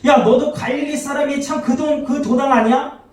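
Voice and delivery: high-pitched, pointed bystander accusation; lightly voiced bystander, high and accusing. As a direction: call him out in front of the crowd. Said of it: "베드로 옆에 있는 사람들이 얘기하는 거예요".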